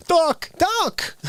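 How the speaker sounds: high, urgent calls; Marty McFly. Retold or imitated. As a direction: yell for Doc, pitch up. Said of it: "I gotta hear Michael J. Fox".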